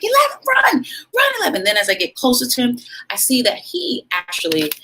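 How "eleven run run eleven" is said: high, loud panicked shout at her son; frantic yell. Retold or imitated. Mother shouting at her son to flee the dogs, high frantic yell. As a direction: scream for your kid to run. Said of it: "And I'm like".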